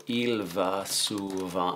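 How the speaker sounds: drawn-out French at typing speed; slow dictation. Shown as a demonstration. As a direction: say each word slowly while typing it. Retyping the sentence aloud.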